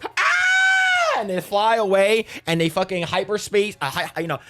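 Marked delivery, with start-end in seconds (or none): 0.1-1.2 high mock scream for the ship; ship whoosh scream. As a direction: yelp much higher like a zooming ship. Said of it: "the ship goes".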